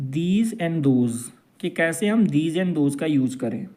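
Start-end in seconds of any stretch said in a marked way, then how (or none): 0.1-1.2 slow teaching drill. Shown as a demonstration. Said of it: "आज हम learn करेंगे".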